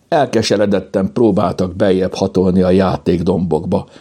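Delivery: ordinary delivery, nothing marked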